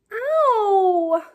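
high loud yelp of pain; sudden pained yelp; dog yelping. Acted. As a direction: cry out like a kicked dog. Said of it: "yelled the dog".

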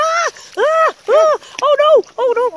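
high, loud panicked yelps as she nearly falls in; slipping toward the mud, crying out in real alarm. Real, not acted. Owner slipping at the swamp edge, panicked yelps. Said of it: "actual panic stations here".

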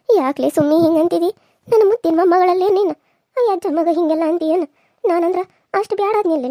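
loud, wounded reproach; wronged elder raising their voice; hurt protest. Acted. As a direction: cry out at a loved one's insult. Hurt grandmother protests at the insult.

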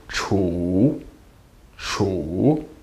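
chu dipped low and soft, third tone. Shown as a demonstration. Teacher contrasts chu with English chew. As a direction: drop chu low and let it curl. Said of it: "That sounds like the word chew".

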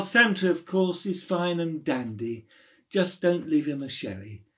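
usual speaking voice

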